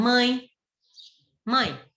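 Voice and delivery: ordinary talk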